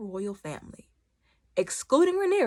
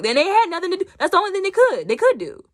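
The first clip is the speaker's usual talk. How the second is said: excited; 12 semitones higher, 6 dB louder, faster than usual. Real, not acted. Excited retelling.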